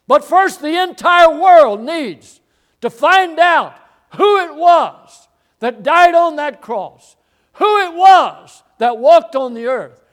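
high, shouted sermon delivery; full-force preaching. Real, not acted. Preacher challenging the congregation about who died, full force. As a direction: drive each phrase home, loud and high.

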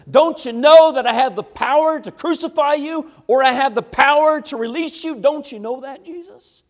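loud, high-pitched, incredulous demand; indignant Pilate. Retold or imitated. Pilate acted confronting Jesus.

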